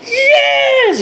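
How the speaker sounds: excited yes. Retold or imitated. Retold excited answer to clerk.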